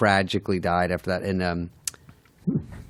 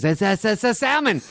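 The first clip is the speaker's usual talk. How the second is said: much higher, sing-song mock waiter; cocky waiter; 13 semitones higher than usual. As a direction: play the confident waiter guessing wrong. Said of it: "he goes".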